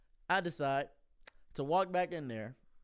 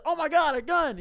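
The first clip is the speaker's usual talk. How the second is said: loud, high shocked cry; shocked customer; 10 semitones higher, 8 dB louder than usual. Retold or imitated. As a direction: yelp it like you just spotted a gun. Customer sees gun, shouts.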